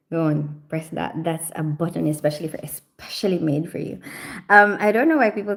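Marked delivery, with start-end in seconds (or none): 0.1-4.0 hushed taunt. Real, not acted. Pointing viewer to the exit.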